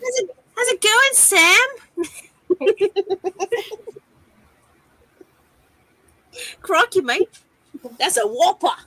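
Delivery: high, loud mock Australian; Australian accent. Shown as a demonstration. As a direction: ham up a cheery Aussie. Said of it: "can I do Australian?".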